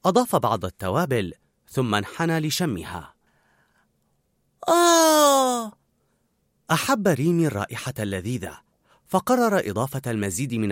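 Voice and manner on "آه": long, high, loud "aah"; delighted sigh. A narrator's reading. Rat sniffing a delicious sauce, delighted long cry.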